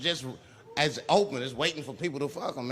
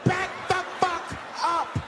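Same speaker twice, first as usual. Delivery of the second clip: high, frantic shouted orders; flipping out; 11 semitones higher than usual. Acted. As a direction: shout like a cornered man, stretching the last words. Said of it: "Now the guy flips out".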